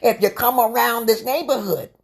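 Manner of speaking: high, twangy hillbilly voice; hillbilly accent. Retold or imitated. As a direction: play a threatening backwoods hillbilly. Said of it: "I can sound like, um, a hillbilly".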